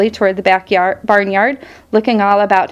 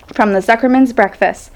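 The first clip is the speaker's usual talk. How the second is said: ordinary delivery, nothing marked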